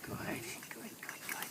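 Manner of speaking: soft breathy whispered "good"; whispered praise. Real, not acted. Trainer whispering praise to the dog holding the bumper. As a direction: breathe the praise to her softly.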